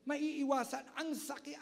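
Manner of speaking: ordinary delivery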